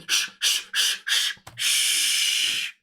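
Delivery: whispered shh over and over; crowd shushing. Retold or imitated. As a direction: hush them like a whole stand. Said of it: "everyone's going".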